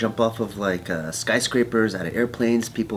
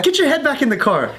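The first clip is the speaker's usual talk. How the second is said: high retold order; exasperated order; 14 semitones higher than usual. Man to girlfriend leaning out, exasperated. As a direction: act out reining someone in.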